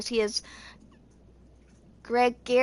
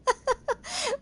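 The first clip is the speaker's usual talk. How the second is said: high, tickled giggle; laugh. Real, not acted. Reader laughing at poor Chong Yun's misfortune, high giggle.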